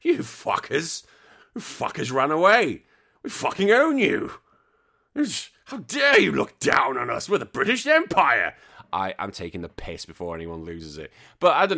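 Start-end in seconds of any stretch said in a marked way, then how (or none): 0.0-8.6 high, indignant imperial rant, half-joking; hammed-up British Empire outrage, pitched up and louder; mock-furious imperialist telling the colonies off; mock outraged Brit. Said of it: "so it's like".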